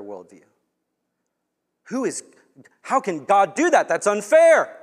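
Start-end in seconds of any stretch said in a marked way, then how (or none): 1.8-4.8 raised, loud and higher, protesting; indignant objection. Pretend. Objector protesting to God, loud indignant outcry. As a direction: voice a challenger crying foul at God.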